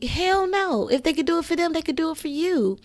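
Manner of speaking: high, punchy refusal; emphatic outburst. Real, not acted. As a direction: reject it with force. Emphatic rejection of excuses.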